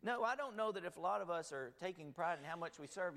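ordinary talk

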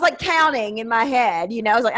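ordinary talk

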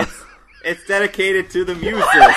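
high and loud through laughter; giggling out the words; laughing. Real, not acted. Cracking up over the name.

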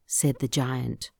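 ordinary delivery, nothing marked

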